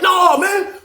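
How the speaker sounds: high, protesting cry; worked-up refusal. Retold or imitated. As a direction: refuse to be calmed. Victim refuses to wait.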